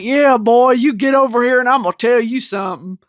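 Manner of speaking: put-on loud Southern good-old-boy Jiko. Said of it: "Redneck Jiko".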